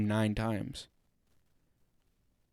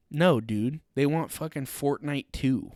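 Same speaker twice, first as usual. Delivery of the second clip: ordinary talk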